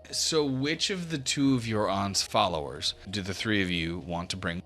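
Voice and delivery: ordinary talk, nothing marked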